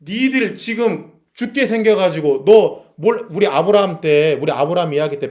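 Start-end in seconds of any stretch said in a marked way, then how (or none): 0.0-3.3 God's angry scolding. Retold or imitated. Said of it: "그러니까 하나님이".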